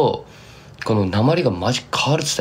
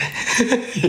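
high breathless laugh; 13 semitones higher than usual. Real, not acted. Laughing at friend's dialect joke.

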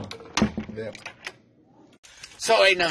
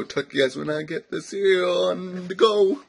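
normal talking voice

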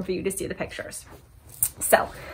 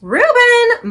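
high, loud call of Reuben; mom yelling; 9 dB louder than usual. Acted. Mom calling Reuben to settle down, yelling. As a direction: shout his name like a fed-up mom. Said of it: "Mom yelled".

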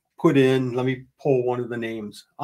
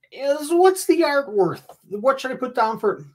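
high exasperated question; 9 semitones higher than usual. Retold or imitated. Retelling his question to Erica.

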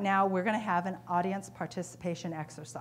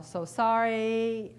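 drawn out, slow and sorry; slow sympathetic apology; 2 semitones higher, 4 dB louder, much slower than usual. Retold or imitated. Speaker offering sympathy to the upset customer, slow and sorry. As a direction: stretch the words out gently. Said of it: "typical responses are".

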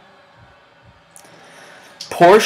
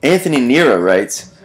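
ordinary delivery

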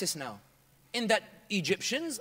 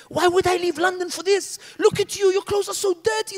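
high, loud furious yelling; retold rant at full force; furious shout; 10 semitones higher, 9 dB louder than usual. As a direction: scream at them in disgust. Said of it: "Astaghfirullah, I even used an ayah".

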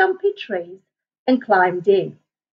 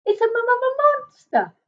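high, slow stuttering squeak; a terrified little creature stuttering; stammering fright; 13 semitones higher, slower than usual. Acted. Bush baby spotting the monster, squeaking stammer. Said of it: "he squeaked in fright".

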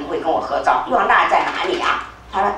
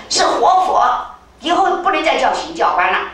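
emphatic correction; 6 semitones higher than usual. Retold or imitated. King insists on title.

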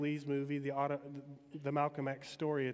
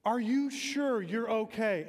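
drawn out, louder and higher, pressing the worry; slow loud concern; 7 semitones higher, 6 dB louder, slower than usual. Retold or imitated. Linebacker calling over the crowd noise to Floyd, slow and loud. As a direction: lean in and ask it slow and loud. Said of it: "Butkus says".

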